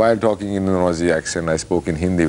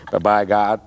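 American accent. Shown as a demonstration. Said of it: "that's American".

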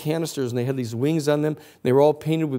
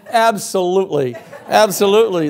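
louder and higher, laughing yes; gleeful agreement; 6 semitones higher, 6 dB louder than usual. Retold or imitated. Protesters happily accepting arrest, laughing loudly. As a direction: cheer it through a laugh. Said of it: "looked around and said".

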